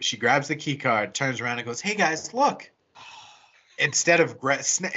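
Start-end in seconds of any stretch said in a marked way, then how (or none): 1.8-2.7 ditzy blonde. Retold or imitated. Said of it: "dunce of the group".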